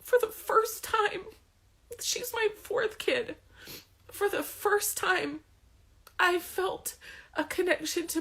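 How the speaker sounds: real tears breaking through as she remembers; tearful. Real, not acted. Mother telling viewers about her newborn, crying. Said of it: "I'm gonna cry again".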